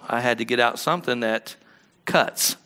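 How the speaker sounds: normal talking voice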